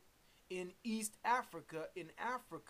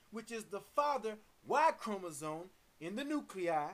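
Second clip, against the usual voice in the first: forceful, measured sermon delivery; emphatic preaching; 3 semitones higher, 4 dB louder than usual. Real, not acted. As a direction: proclaim it in weighty, punched phrases. Preaches on God and atom.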